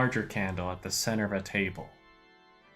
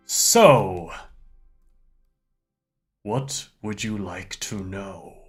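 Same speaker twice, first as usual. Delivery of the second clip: mystic seer, loud opening then hushed; fortune teller; 5 dB louder than usual. Acted. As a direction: lean in like a mysterious seer. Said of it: "he puts his hands on the table and he says".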